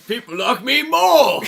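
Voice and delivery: loud, high, drawn-out boast; hammed-up gloat, slow and high; mock gloating. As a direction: crow it slowly and loudly for laughs.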